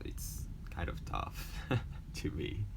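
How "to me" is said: usual speaking voice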